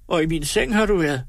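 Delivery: a hint of weeping woman; crying. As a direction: blame him through mock tears. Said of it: "nu græd Tripstine".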